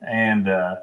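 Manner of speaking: normal talking voice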